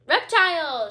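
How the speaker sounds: Reptile! yelled high and very loud; queen yelling Reptile! as she throws the book. Acted. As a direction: hurl Reptile! like an insult. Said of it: "she yelled".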